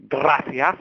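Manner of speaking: a cheery stab at Spanish, gracias; Spanish accent. Real, not acted. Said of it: "you really have to work on your Spanish accent".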